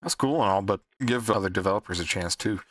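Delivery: ordinary talk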